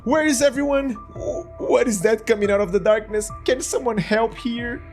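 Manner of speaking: ordinary talk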